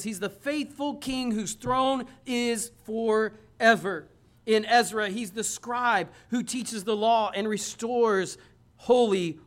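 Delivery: preacher proclaiming Christ through every book; fervent preaching. Acted. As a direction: build it like a roll call from the pulpit. Litany of books, preached.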